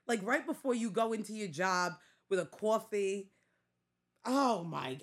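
ordinary talk

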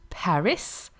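Paris said the English way, final s sounded; English pronunciation. Shown as a demonstration. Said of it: "not pronounced like in English".

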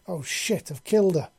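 sudden loud panicked cry; panicked yell. A narrator's reading. Queen yells in panic over collapsed hatchling, loud. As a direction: shout it out in alarm. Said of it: "the queen yelled".

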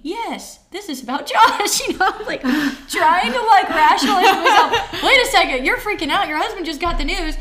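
loud, high, laughing through the retelling; laughing self-scolding. Retold or imitated. Wife talking herself out of freaking out, laughing self-rebuke.

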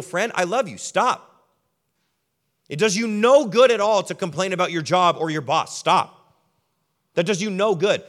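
a friend loudly cutting off a friend's complaining; firm loud rebuke. Retold or imitated. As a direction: plead and command at once, raising your voice. Friend firmly telling friend stop.

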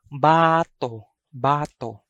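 bato as BAA-to, falling; long first syllable in bato. Shown as a demonstration. Teacher models the word for throwing, bato with long BAA. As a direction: stretch the first vowel of "bato". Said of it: "that's the first sound or pronunciation".

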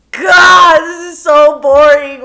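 loud, slow groaning complaint; bored shout. Retold or imitated. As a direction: wail it out loud, fed up. Bored outburst retold in car.

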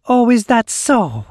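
high, loud sneering oily question. Acted. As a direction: drip with oily mockery. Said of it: "she answers in an oily voice".

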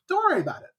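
airy evasive deflection; evasive high Amos. Retold or imitated. Amos dodging question.

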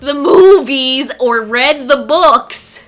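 playfully exasperated shout; exasperated retort. Real, not acted. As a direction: belt it out slowly as if it's obvious. Exasperated retort over co-host's protest.

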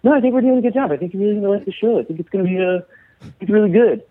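nervous newcomer defending the show in a high mock voice; meek reassurance. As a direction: squeak out polite reassurances to hostile locals. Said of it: "And I'd be like".

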